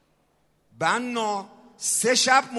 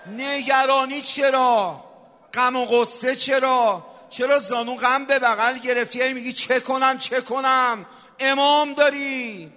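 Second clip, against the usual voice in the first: impassioned preaching; 7 semitones higher, 6 dB louder than usual. Real, not acted. Preacher challenging worried listeners to trust the Imam, impassioned preaching. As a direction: preach at full force, stretching the phrase endings.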